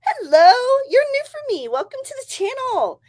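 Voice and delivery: high, loud surprised hello; light up at the newcomer; delighted hello. Real, not acted. Spotting a new viewer, high and delighted.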